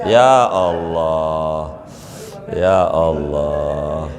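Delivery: slow, drawn-out sigh of Ya Allah; mock lament. Weary imam lamenting his lot, slow drawn-out sigh. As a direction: groan it out slowly like a weary imam.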